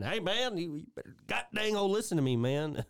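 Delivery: imitated frustration; Boomhauer. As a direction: plead to be listened to, mock exasperated. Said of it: "Boomhauer genuinely frustrated".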